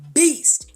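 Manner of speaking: beast punched high and loud; emphatic beast. Shown as a demonstration. Fragrance vlogger hyping a perfume, punching beast. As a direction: lean hard on beast. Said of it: "Hear me when I say it. Heavy on it".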